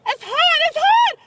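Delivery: high, loud distressed wailing; pained cries. Real, not acted. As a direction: cry out that it burns. On hot ground, crying out.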